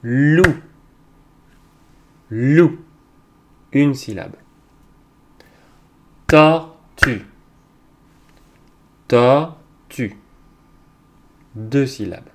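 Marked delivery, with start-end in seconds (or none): 0.0-2.8 Loup as one loud, high beat; loud, raised Loup set apart by silence; syllable beat. Shown as a demonstration. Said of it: "frapper les syllabes". 6.3-10.2 Tortue split into tor and tue, loudly; loud, segmented Tortue with a clear gap; syllable by syllable. Shown as a demonstration. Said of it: "frapper les syllabes".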